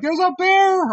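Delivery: high, loud yelled alert; shouted warning. Retold or imitated. A lady on shore warning the floaters, high loud shout. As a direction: sound the alarm from the bank. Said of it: "a lady's like".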